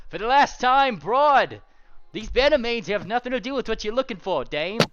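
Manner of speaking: put-on fed-up old-movie gangster; noir tough guy. Zebra snapping at the pink pony, gangster style.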